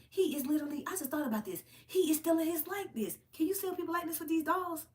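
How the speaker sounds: high, indignant disbelief; high outraged gasp of discovery; outraged realization. Real, not acted. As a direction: pitch way up, shocked and indignant. Outrage over stolen likeness.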